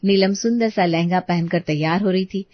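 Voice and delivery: ordinary delivery, nothing marked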